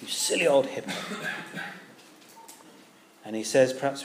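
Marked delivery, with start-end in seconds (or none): 0.0-0.9 lightly put-on very posh English accent; a hint of posh English gentleman; John Stott. Old London preacher advising the bitter, very posh English accent. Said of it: "imagine him in a very posh, uh, E-, English accent".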